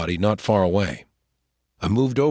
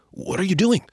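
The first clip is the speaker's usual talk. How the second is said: much higher startled cry; startled exclaim; 10 semitones higher than usual. A narrator's reading. Boy yanked by the wounded arm, startled high exclaim. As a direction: jump at being grabbed. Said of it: "I exclaimed".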